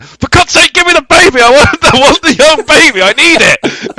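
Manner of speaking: high, loud, frantic demand; desperate outburst. Retold or imitated. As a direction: act out a man breaking, pleading at full pitch. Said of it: "but the bloke broke".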